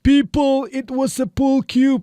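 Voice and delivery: high, slow repeat of the movie line; imitating film line. Shown as a demonstration. Teacher repeating a movie line for students. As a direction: echo the actor's call to the crowd. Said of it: "repeating the film line".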